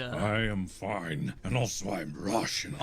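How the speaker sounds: slow mock Russian accent; a hammed-up Russian guard. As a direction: lean into the Russian, slowly. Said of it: "Also very Russian".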